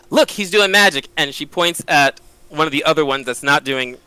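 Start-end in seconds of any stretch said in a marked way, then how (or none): 0.1-1.1 mildly raised, pointing it out; a light touch of pretend amazement; staged alarm. Penny pointing out a goblin to another, mild staged alarm. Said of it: "and she's like".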